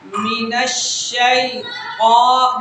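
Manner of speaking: الشیطان with a heavy ط and flat, unrounded lips; الشیطان, heavy ط, no lip rounding; thick ta. Shown as a demonstration. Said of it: "دیکھیں میرے ہونٹ سیدھے ہیں".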